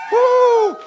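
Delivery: loud high whoop; cheering woo. Real, not acted. Pastor whoops to rally the football crowd, loud cheer. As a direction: whoop like a fan in the stands.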